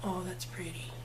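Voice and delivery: hushed, breathy admiration; whisper. Real, not acted. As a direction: whisper it in awe. Admiring her eye, whispering.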